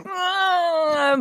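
a high, hammed-up wailing cry; mock wail. Shown as a demonstration. Teacher shows the students a bawling wail, mock wailing. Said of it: "the ong ong is just wailing, like".

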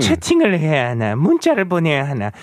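animated, fast, high-pitched read-out; listener message read. Acted. Radio host reading a listener's chat message, animated high read-out. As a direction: perform the message brightly and quickly, pitch swooping up.

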